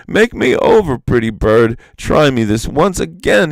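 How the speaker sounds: high, loud, desperate plea; pleading. A narrator's reading. Iktomi begging the rare bird to remake him, loud high plea. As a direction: beg the bird with everything. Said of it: "he pleaded in vain".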